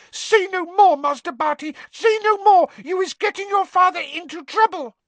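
very high, loud desperate elf voice; pleading elf squeal. A narrator's reading. Winky begs her master to stop confessing, frantic squeak. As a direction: beg him frantically to stop talking.

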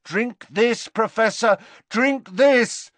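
high, loud insistence; urgent pleading. Acted. Harry urging screaming Dumbledore.

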